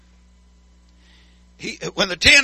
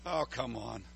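ordinary talk, nothing marked

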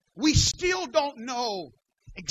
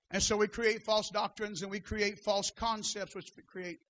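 dropping quiet; 2 semitones lower, 8 dB quieter than usual. Real, not acted. Drops after climax.

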